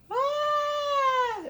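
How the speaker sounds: high mock scream of a child; imitated baby scream. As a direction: squeal like a fallen baby. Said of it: "somebody basically goes".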